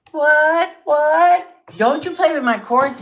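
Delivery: high, loud shriek at the cat; startled scolding. Real, not acted. Scolding her cat for playing with the cords, loud and high. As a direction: snap a shrill warning at a pet.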